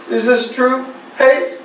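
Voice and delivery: ordinary talk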